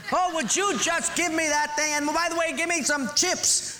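preacher hams up a beer-belly husband; mock yelling husband. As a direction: holler from the armchair. Husband voiced in joke story.

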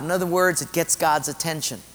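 usual speaking voice

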